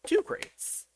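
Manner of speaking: silly squeaky high Two crates. Pretend. Repeating the name playfully.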